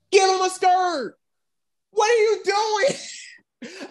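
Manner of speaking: very high, strained complaint; high-pitched exasperated plea; exasperated squawk. Real, not acted. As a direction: drag the question out high in disbelief. Exasperated rant about the Red Ranger.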